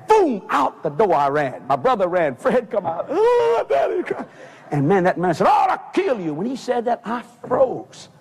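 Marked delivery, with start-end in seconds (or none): 3.1-4.0 high-pitched wailing cry; mock hollering. Fred running out to his daddy, hollering. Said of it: "He was a hollering". 5.5-6.4 high mock-angry threat; retold threat. Drunk father to the boy, mock-angry.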